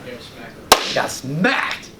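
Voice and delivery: ordinary talk, nothing marked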